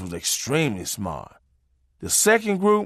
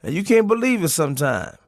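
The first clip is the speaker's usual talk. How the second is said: ordinary talk, nothing marked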